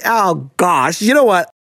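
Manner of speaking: usual speaking voice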